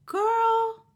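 put-on a high, delighted squeal of "Girl"; excited exclamation. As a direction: imitate her overjoyed burst of surprise. Stranger reacts to a compliment.

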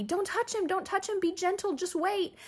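high, soft, hurried cautions; high-pitched mock pleading; imitated fretful warnings. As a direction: mimic a fussing husband in a high voice. Imitates husband's anxious repeated warnings.

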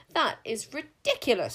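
high, loud, scornful snap; snapped. A narrator's reading. Queen dismissing the king witch's threat, snapping. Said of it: "snapped Queen Sycorax".

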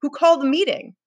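high, exasperated outburst; shouted. A narrator's reading. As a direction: fire off angry questions, voice way up. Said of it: "shouted Ralph".

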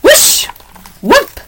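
high, sudden whoosh and whoop; whooshing noise. A narrator's reading. Noises from the fireplace in story.

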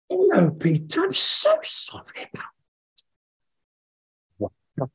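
very high, breathless squeak; mortified apology. Retold or imitated. Fan realises it really is Peter, mortified. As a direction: act out horrified, gushing embarrassment.

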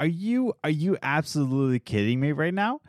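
usual speaking voice

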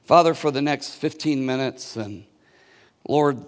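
low, soft prayer voice. Real, not acted. Pastor opens a prayer for the church, soft and low. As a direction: speak to the Father quietly.

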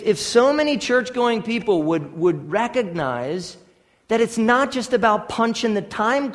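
preacher building to a passionate call; fervent preaching. Acted. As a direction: rouse the congregation to want Christ. Preaching at full force.

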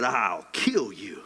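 slow, low retold threat; mock threat. Jezebel's threat, acted slowly.

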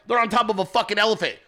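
ordinary delivery

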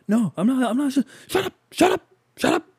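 put-on high, childish whining protest; whiny kid. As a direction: talk back like a bratty little boy. Kid arguing back.

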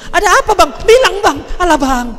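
panicky pestering. Pretend. Wife pestering her frightened husband, loud mock panic. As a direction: badger him, all nerves.

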